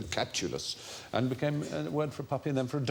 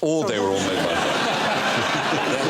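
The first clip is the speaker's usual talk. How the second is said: laughing; 4 dB louder, much slower than usual. Real, not acted. Host repeats the joke, laughing.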